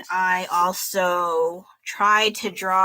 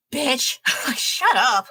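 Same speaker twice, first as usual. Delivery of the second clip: laughing retort; 6 semitones higher, much slower than usual. Retold or imitated.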